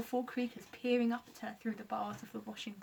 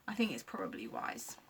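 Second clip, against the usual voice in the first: low aside; 9 semitones lower than usual. Acted. Low comic aside to himself.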